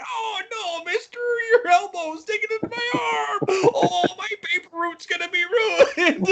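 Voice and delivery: very high, crying, squawking wail; whiny squawky voice. Retold or imitated. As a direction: shriek it like an overgrown crybaby. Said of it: "real kinda whiny and squawky".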